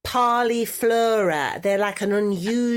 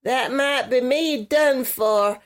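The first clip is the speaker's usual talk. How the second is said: put-on southern US accent; 3 semitones higher than usual. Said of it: "that's your best accent".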